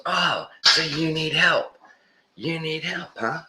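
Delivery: high, taunting mock-berating voice. Man berating a homeless guy who wants a phone, taunting. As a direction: sneer it out like a jerk rubbing it in. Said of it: "He just berating the guy".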